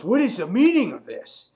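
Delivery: loud, high-pitched acted outrage; freaked-out demand. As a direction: demand it, imitating someone flipping out. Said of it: "freaked out".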